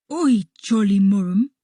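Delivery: loud, indignant character voice; Harby protesting. A narrator's reading. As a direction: cry out "oi" and rise at the end. Harby protests after rib elbow.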